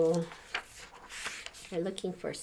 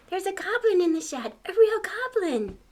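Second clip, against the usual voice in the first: excited; 11 semitones higher, 7 dB louder than usual. Acted. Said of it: "Elliot tried to tell his family".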